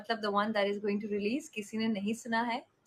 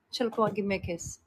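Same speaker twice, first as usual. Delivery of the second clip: normal talking voice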